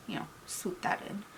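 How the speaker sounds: hushed murmur while doing makeup; whispered aside. Real, not acted. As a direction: drop to a soft whisper. Whispering while applying eyeshadow.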